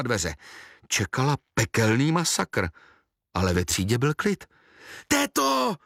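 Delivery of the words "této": very high-pitched acted cry; high, excited storybook yell; children's shout. A narrator's reading. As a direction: call out like two children at once. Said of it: "vykřikli naráz Vraždožrava a Pim".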